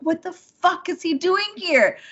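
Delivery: high, retold shock; stunned at seeing him there; shocked disbelief. Woman retells the ex showing up, shocked. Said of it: "I was like".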